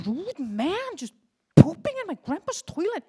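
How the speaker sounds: put-on child fuming at the stranger in bed; exasperated rant. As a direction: blow up at the rude man. Child's exasperated bedtime thought.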